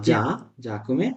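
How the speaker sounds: ordinary talk, nothing marked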